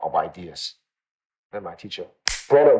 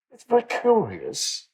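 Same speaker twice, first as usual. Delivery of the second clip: lightly imitated British accent, high and slow; a hint of a proper British gentleman, drawn out; 8 semitones higher than usual. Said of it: "with his British accent".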